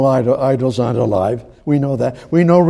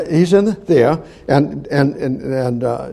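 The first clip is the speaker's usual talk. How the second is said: ordinary talk, nothing marked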